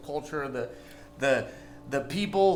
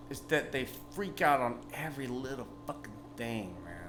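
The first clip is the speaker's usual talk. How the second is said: whispered gripe; 4 semitones lower, 7 dB quieter than usual. Real, not acted. Sudden whispered complaint.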